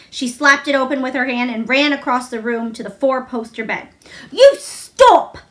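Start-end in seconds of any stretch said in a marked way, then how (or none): 4.3-5.4 high, loud furious shout; near shout. A narrator's reading. Mary shouting at the screaming boy to stop. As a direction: yell it at him with full force. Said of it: "she almost shouted".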